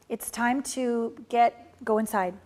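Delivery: ordinary talk, nothing marked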